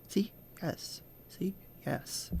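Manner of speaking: soft, breathy murmured yes; mimicking the interpreter. Interpreter translating si for the jury, soft murmured mimicry. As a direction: murmur it barely voiced, deadpan. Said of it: "the interpreter would go".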